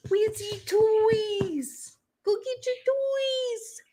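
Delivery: very high, slow pet voice. Real, not acted. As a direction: coax the dog sweetly. High pet talk to dog.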